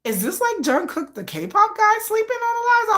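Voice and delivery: high, astonished squeal; high incredulous squeal of discovery. Retold or imitated. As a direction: gasp it out in disbelief. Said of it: "I was like".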